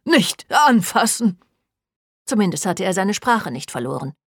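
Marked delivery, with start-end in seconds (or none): 0.0-1.4 slow, raised, wounded protest; higher, aggrieved warning; pained yell. Acted. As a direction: cry out to keep hands off. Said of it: "schrie er".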